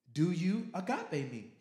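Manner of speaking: loud and slow, leaning on agape; pressing question. Acted. Jesus pressing Peter a second time, loud. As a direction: demand it.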